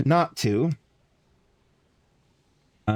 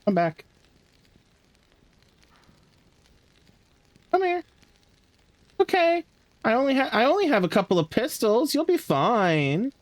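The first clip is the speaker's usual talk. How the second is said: coaxing call; 12 semitones higher than usual. Pretend. Coaxing an enemy in game.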